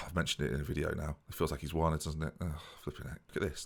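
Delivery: deflated, barely above a murmur; quiet deflation. Real, not acted. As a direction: sink into quiet embarrassment. Deflated after embarrassing remark.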